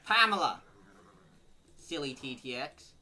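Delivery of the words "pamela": loud amused exclaim. Real, not acted. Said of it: "repeats the name".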